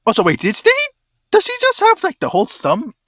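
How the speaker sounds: loud, high, incredulous question; startled disbelief. Real, not acted. Player reacting to a whole severed thumb, startled loud disbelief. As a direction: blurt it out loudly, pitch jumping up.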